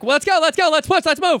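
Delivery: high, urgent hustling; hurried director. Retold or imitated. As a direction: rush the crew along. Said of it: "a lightning fast guy that's like".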